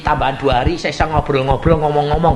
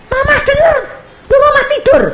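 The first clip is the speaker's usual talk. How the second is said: much higher, louder amazed cry; astonished; 7 dB louder than usual. Retold or imitated. Children amazed that their sleeping mother heard everything. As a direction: gasp it out in disbelief. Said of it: "children astonished at mother".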